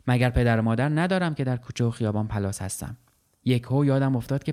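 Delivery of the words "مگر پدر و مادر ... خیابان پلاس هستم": ordinary delivery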